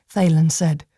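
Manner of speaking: ordinary delivery, nothing marked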